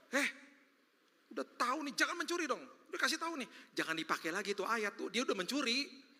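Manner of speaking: high, scolding busybody voice; scolding church people. Retold or imitated. Church folk scolding a thief, high and preachy. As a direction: wag the finger at the thief.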